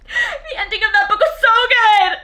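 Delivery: high, loud, laughing delight; laughing gush. Real, not acted. Praising the book's ending to viewers, laughing.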